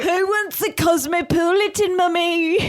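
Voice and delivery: very high-pitched mock bartender son; Max impression. Son offering Mummy cocktails, high hammed-up voice. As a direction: squeal it like an over-eager helper. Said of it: "He wakes up in the middle".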